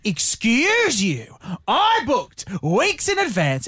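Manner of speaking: slow, drawn-out retold indignation; showing off. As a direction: stretch each word like an entitled customer. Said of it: "I was trying to show off".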